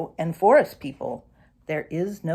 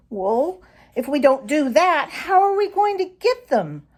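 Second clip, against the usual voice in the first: put-on higher, complaining young fox; young fox grumbling, pitch rising higher; grumbled; 7 semitones higher than usual. A narrator's reading. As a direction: whine it like a thwarted pup. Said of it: "he grumbled".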